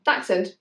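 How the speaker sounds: "Dachshund" said as DAK-sund, falling at the end; correct pronunciation. Shown as a demonstration. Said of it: "It is Dachshund".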